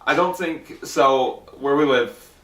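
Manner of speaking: normal talking voice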